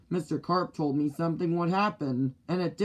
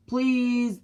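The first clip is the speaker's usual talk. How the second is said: pleading; 7 semitones higher, 3 dB louder than usual. Acted. Boy begs on phone.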